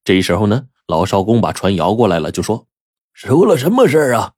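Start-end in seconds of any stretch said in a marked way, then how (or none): 3.1-4.3 raised, high-pitched questioning call; loud, urgent shout of inquiry; calling out loudly. A narrator's reading. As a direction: call the question out across the water. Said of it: "就高声地问道".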